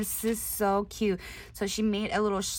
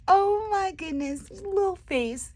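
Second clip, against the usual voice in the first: high gush; 10 semitones higher than usual. Real, not acted. Crafter cooing at a monkey face, high gush. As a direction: coo at its little face.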